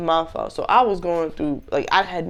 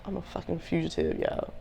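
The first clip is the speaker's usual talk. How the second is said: much softer, deflated after a sigh; a softer, beaten-down aside to viewers; defeated hush; 11 dB quieter than usual. Retold or imitated. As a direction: let it drop out like you give up. Sighing over arrest warrant.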